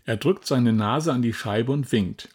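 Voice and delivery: plain reading voice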